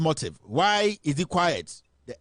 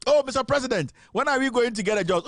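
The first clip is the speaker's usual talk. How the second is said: mock plea; 7 semitones higher than usual. Mocking pleading citizens.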